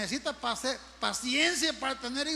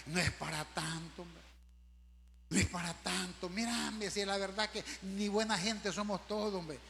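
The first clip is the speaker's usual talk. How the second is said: soothing friend; 4 semitones lower, 5 dB quieter than usual. Acted. Voicing a calming friend.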